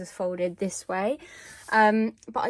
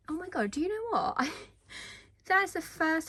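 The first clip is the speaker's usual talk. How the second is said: surprised; 7 semitones higher than usual. Real, not acted. Sudden discovery of symbol.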